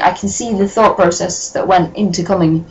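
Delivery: ordinary delivery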